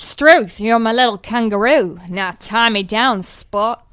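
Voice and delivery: put-on a light Australian accent. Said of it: "an Australian accent".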